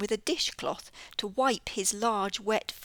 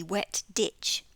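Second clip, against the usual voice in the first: plain reading voice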